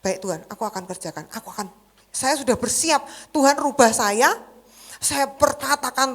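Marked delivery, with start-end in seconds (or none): 0.0-1.7 softer, fast surrender to God; quiet hurried prayer. Retold or imitated. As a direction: murmur a quick obedient vow. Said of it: "dan Tuhan bilang". (doa pelan dan cepat)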